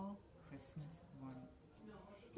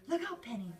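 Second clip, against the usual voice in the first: loud, higher warning call; screaming; 8 semitones higher, 9 dB louder than usual. A narrator's reading. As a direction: call out the danger. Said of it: "screamed Nick".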